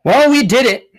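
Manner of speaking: high and loud, a burst of mock triumph; loud exclamation. Real, not acted. Admitting they woke the neighbor again, loud high burst. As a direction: shout it like a proud confession.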